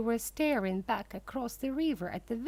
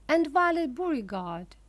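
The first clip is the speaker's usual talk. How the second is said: plain reading voice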